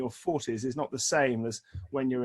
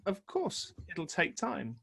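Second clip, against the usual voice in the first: softer, higher-pitched reassurance; quiet matter-of-fact; 3 semitones higher, 8 dB quieter, slower than usual. Retold or imitated. The doctor telling the patient it heals itself, softly. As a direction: say it softly, like it's obvious. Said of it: "He said".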